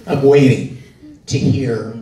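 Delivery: usual speaking voice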